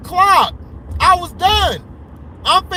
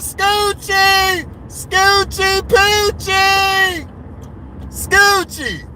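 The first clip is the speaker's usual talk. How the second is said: drawn-out teasing call of the name; mock sing-song nickname; 5 semitones higher, much slower than usual. Real, not acted. Taunting a rival fan in chat, sing-song teasing. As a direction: stretch the nickname out to tease a friend.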